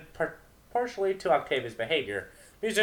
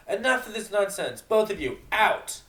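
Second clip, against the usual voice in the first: furious shout; 9 semitones higher, 8 dB louder than usual. Acted. Captain throws them out.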